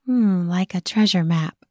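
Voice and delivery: plain reading voice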